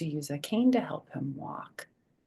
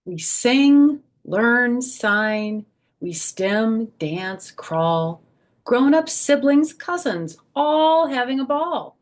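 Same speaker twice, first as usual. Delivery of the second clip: higher, louder sing-song rhyme; reading to children; 6 semitones higher, 8 dB louder, slower than usual. A narrator's reading. Grandma reading rhyming picture book to kids, sing-song. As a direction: bounce the rhymes for little listeners.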